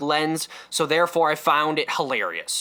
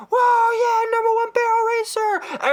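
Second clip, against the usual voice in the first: high, shouted crowd cheer; 4 dB louder than usual. Retold or imitated. Comedian voices the crowd cheering the bronco rider, shouted. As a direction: yell it like a hyped fan.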